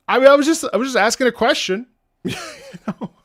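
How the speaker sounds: high, loud self-defence; protesting. Real, not acted. Defending self to chat after embarrassing story, high loud protest.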